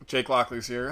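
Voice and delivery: ordinary talk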